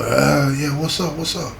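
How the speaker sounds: sleepy, soft mumble of a man just woken; groggy half-awake mumble. Retold or imitated. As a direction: mumble it like you're barely awake.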